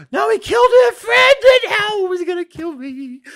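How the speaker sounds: high, loud, frightened yell; panicked shout. Real, not acted. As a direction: cry out in real fear. A mob is chasing her.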